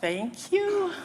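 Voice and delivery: high-pitched, drawn-out thanks; acted thanks. Rafter answering a useless well-wisher, high-pitched thanks. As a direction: call back a helpless thank-you. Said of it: "And she said".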